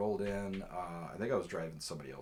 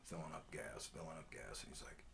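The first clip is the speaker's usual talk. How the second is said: ordinary delivery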